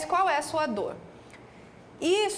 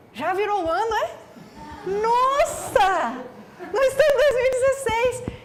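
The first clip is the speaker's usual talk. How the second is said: imitando espanto, bem mais agudo e alto; exclamação assustada e aguda, terminando em riso; 9 semitones higher, 6 dB louder than usual. Retold or imitated. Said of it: "Aí ela fez assim". (paciente idosa descobre que o ano virou, bem espantada e aguda)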